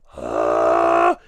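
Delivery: loud epiglottal growl. Shown as a demonstration. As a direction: jazz singer growl.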